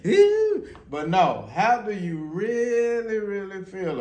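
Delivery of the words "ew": high, loud mock squeal; mock surface reaction. Parent mimicking a surface reaction, high hammed-up squeal. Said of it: "Not that surface".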